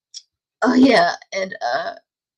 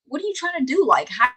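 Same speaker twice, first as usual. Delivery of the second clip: exasperated scolding; 6 semitones higher, faster than usual. Retold or imitated. Scolding fiend over toilet paper.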